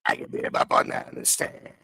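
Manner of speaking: voiceless croaked mobster line; mock whisper of the witness; whispered rasp. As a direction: rasp it on breath alone. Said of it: "Why do you talk like that?".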